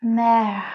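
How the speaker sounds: careful French mer; French pronunciation. Shown as a demonstration. Teacher models the French word for the class. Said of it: "इसका sound होता है".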